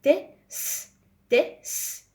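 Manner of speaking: ت and س whispered as bare sounds; breathy letter sounds t, s; sounding out letters. Shown as a demonstration. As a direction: phonics sounds for the class. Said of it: "ت أولية".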